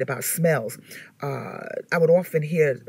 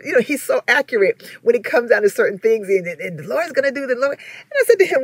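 ordinary talk

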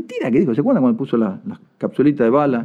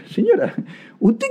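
mock exasperated address; 6 semitones higher than usual. Minister's retort voiced, pitched up.

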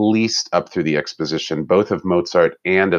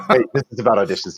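quick, laughing self-correction; 3 semitones higher, faster than usual. Real, not acted. Realizing she drifted off topic, laughing quickly. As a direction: catch yourself drifting and laugh it off.

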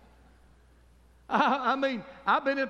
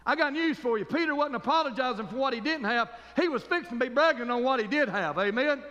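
usual speaking voice

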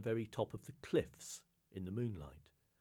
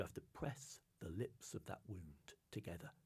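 softer, whispered command; whispered instruction; 9 dB quieter than usual. Acted. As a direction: breathe it out like a secret. Seal woman's solemn instruction.

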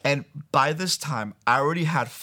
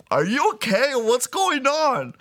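high, slow, concerned mother asking; worried mom; 11 semitones higher, 4 dB louder than usual. Retold or imitated. Mom checking on her scared child, high and slow. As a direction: ask gently like a worried mom.

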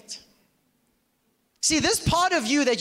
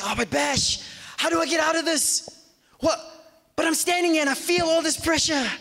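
higher, pushed, fretful protest; mock anxious objector; 7 semitones higher than usual. Preacher voicing a stressed congregant's protest to him, high and fretful. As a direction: play a stressed listener crying out.

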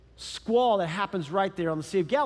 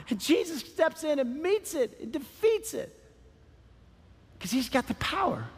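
raised pitch, building with conviction; impassioned preaching; 5 semitones higher than usual. Real, not acted. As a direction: preach it as a rising declaration of victory.

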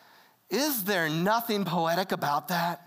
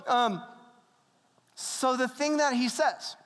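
normal talking voice

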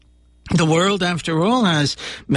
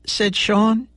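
ordinary delivery, nothing marked

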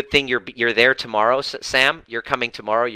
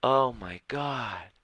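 dawning realization; 8 dB quieter, much slower than usual. Retold or imitated. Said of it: "she was like".

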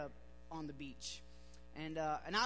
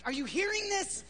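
high, loud alarmed wife; wife waking him, higher and louder, alarmed; 11 semitones higher, 8 dB louder than usual. Retold or imitated. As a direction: shake him awake in a panic. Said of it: "she was like".

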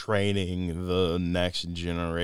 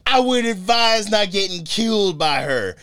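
high, loud, slow mock movie hero; Rick Flag; 11 semitones higher, 7 dB louder, slower than usual. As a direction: boom out the trailer line grandly. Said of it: "I just wanted to Rick flag you".

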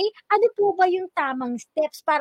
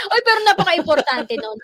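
laughing; 5 semitones higher, 6 dB louder than usual. Real, not acted. Laughing at the soundproof joke.

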